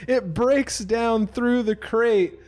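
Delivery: slow, high, loud narration of the smash; dramatic slam narration. Acted. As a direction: drag out the crash for drama.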